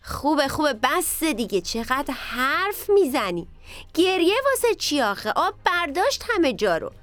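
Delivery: high-pitched exasperated scolding; impatient potato. A narrator's reading. Potato tells weepy onion to stop talking, impatient and high.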